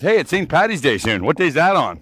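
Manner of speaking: usual speaking voice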